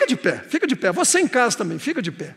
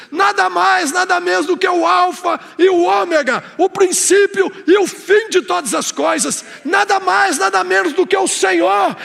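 louder and higher with each nada mais; building preaching litany; 4 semitones higher, 4 dB louder than usual. Acted. Pastor proclaiming who Jesus is, rising fervent litany.

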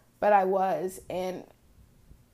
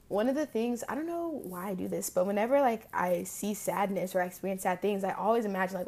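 usual speaking voice

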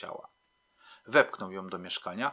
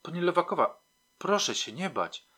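raised, anxious plea; mildly panicked reassurance; frightened call; 8 semitones higher, 4 dB louder than usual. A narrator's reading. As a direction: call out to her, scared yourself. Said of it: "zawołałem, równie przerażony jak ona".

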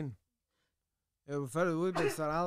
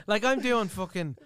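ordinary talk, nothing marked

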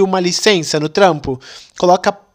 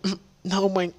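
weak sick murmur; 11 dB quieter than usual. Retold or imitated. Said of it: "Eu falei".

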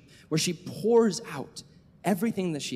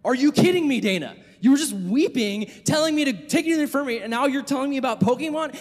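loud, high incredulous scolding; mock outrage, pitched up and punchy; ticked off; 9 semitones higher, 6 dB louder than usual. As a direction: re-enact an exasperated counselor telling a kid off. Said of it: "I was so ticked off".

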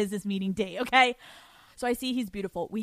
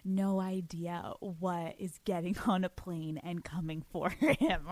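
scheming; 3 semitones lower, 6 dB quieter than usual. Retold or imitated. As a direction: plot sweetly against him. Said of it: "I'm like".

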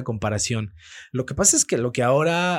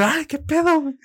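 high-pitched retold yelp of alarm; mock fright, pitch shooting up; startled; 4 dB louder than usual. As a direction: blurt it out as if jumped by a stranger. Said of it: "Y yo me asusté".

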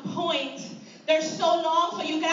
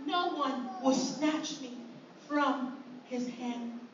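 much softer and lower, slowing; soft trust; 5 semitones lower, 7 dB quieter, slower than usual. Acted. Believer to the congregation, hushed and trusting. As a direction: let it sink in as a quiet confession. Believer quoting scripture softly.

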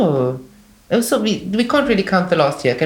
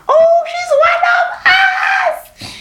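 excited crowd; 3 dB louder, much slower than usual. Retold or imitated. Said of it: "they were like".

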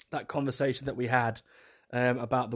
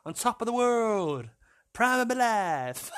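drawn-out, higher mock boast; hammed-up exuberance; 9 semitones higher, slower than usual. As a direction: stretch it out high, playfully over the top. Hams up the phrase, stretched out.

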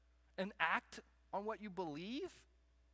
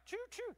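train whistle imitation. Shown as a demonstration. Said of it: "I'm not even gonna try".

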